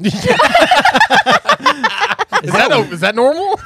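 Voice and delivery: high laughing question through giggles. Real, not acted. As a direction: laugh while asking. Cracking up at brag.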